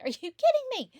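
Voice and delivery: a high, loud squeal of disbelief; exasperated disbelief. Retold or imitated. To herself on finding the laptop fried, a high loud disbelieving shriek. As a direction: shriek it like your laptop just died. Laptop dead after coffee spill.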